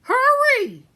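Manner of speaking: very high, very loud scream of Hurry; furious shout. Acted. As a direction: scream at the maid to move. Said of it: "shouted Cook".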